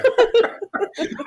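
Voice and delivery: laughing out loud, high; high burst of laughter. Real, not acted. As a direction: let a big high laugh out.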